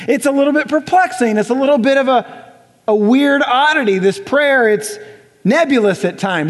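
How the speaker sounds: ordinary talk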